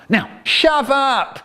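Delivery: slow, drawn-out bossy command; slow cocky shove-up; bossy order. Acted. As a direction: stretch it out, pushing him aside. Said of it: "Then I said".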